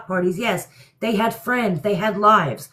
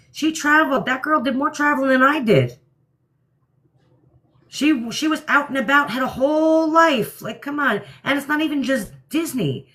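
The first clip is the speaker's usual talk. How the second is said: higher, heated listing of everything she did; pitched-up exasperated defense; indignant rant; 5 semitones higher than usual. Real, not acted. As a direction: rattle it off, fired up and defensive. Defending Dee Dee against claims.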